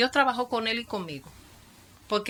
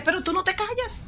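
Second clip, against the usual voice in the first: quoting people, pitched up high in mock surprise; teasing exclamation; 10 semitones higher than usual. People teasing the once-silent youth, mock surprise. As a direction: tease him for talking nonstop. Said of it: "la gente dice".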